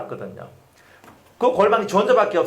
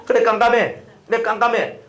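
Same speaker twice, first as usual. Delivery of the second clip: playful, raised "깜깜해" answer; teasing the listeners with their own reply; mock congregation reply; 5 semitones higher, 4 dB louder than usual. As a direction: answer back lightly, louder and higher. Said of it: "모르면 깜깜하다 하면 돼요".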